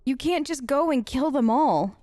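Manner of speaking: ordinary delivery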